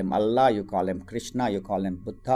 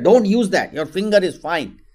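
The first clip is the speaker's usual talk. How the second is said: higher, loud sharp mother's order; scolding mother; 7 semitones higher, 6 dB louder than usual. Acted. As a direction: snap at the older son, then soothe. Mother scolds then reassures.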